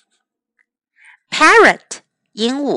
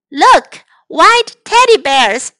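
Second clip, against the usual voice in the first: put-on high, louder girl's call; higher, louder little girl; excited call; 6 semitones higher, 6 dB louder, slower than usual. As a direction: call out delighted at the bears. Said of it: "said Topsy".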